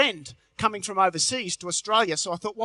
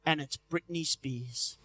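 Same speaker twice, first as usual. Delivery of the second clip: deadpan low; 6 semitones lower, 10 dB quieter, slower than usual. Acted. Deadpan punchline.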